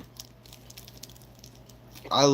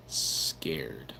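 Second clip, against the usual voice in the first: mock scared whisper; 9 semitones lower, 8 dB quieter than usual. Real, not acted. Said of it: "I'm actually getting".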